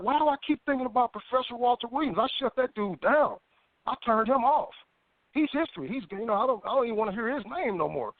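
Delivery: retold exasperation, pitched well up; annoyed protest. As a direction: argue back at your own nagging thought, high-pitched. Retelling his annoyed reply to thought.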